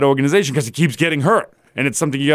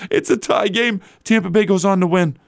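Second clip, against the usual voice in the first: ordinary talk, nothing marked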